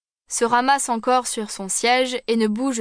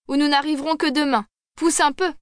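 hurried urging; 5 semitones higher, faster than usual. Acted. Officer hurrying the cabman.

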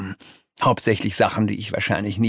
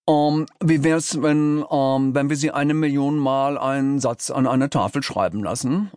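halting creature voice, full of ums; unsure, faltering suggestion; hesitant underling; 3 semitones higher than usual. A narrator's reading. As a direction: pitch the idea timidly to the boss. Nervous underling pitches an idea.

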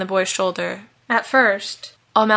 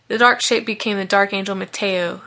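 ordinary delivery

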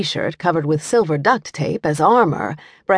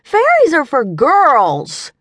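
put-on high, loud cross little boy; boy annoyed at the story, interrupting; cross child; 10 semitones higher, 6 dB louder, much slower than usual. A narrator's reading. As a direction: snap out the correction. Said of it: "the dragon interrupted crossly".

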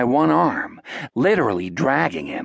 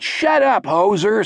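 high-pitched heckler's yell; irritated shout from the crowd; 8 semitones higher, 3 dB louder than usual. A narrator's reading. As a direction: yell it across a cinema. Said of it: "someone else yelled".

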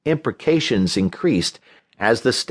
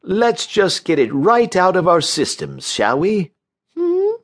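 high, oily coaxing; cloying, lilting high therapist voice; unctuous; 9 semitones higher than usual. A narrator's reading. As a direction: coax with syrupy condescension. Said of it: "he said unctuously".

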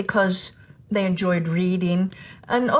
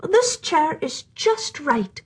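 sighed; 6 semitones higher, much slower than usual. Acted. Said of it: "she sighed".

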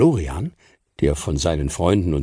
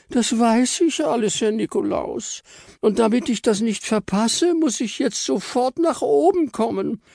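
squeaky Frau Stern. Acted. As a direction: plead sweetly in a tiny high voice. Frau Stern, hands on hips.

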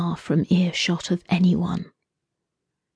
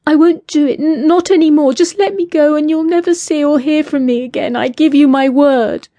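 much higher, louder, rushed panic; desperate frightened outburst; 9 semitones higher, 4 dB louder than usual. A narrator's reading. Captive woman desperately begging her captor, panicked and loud. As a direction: blurt it out in panic.

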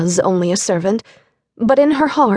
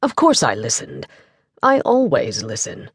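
low, warm gentleman's reassurance; deep-pitched, affectionate reply; warmer; 10 semitones lower than usual. A narrator's reading. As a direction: drop low and reassure her warmly. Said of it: "his voice became warmer".

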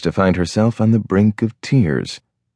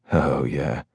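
much softer, lower murmur; 5 semitones lower, 7 dB quieter than usual. A narrator's reading. Husband answering his wife, low and soft. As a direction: say it low and close.

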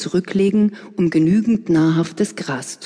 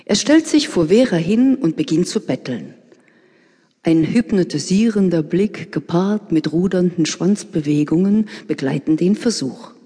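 usual speaking voice

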